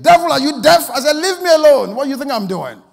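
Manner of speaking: high, full-volume exasperated yell. Retold or imitated. Tired preacher rebuking what he thinks is the devil, yelling. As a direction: yell furiously at an intruder who won't leave. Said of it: "he yelled again".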